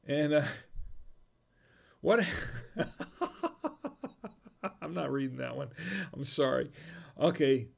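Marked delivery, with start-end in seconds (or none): 2.3-4.3 high breathless giggle; giggle fit. Real, not acted. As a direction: burst into helpless giggles. Can't read the joke.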